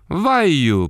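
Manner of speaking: high, loud barker's call; shrill falsetto cry. Acted. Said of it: "повторила дрессировщица".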